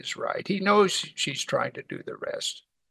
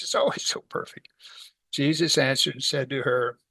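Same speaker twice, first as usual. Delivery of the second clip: normal talking voice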